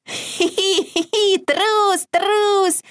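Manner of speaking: high squealing piggy taunt; trickster spirit. A narrator's reading. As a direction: giggle and jeer like a naughty piglet. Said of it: "хрюкал Изюмка".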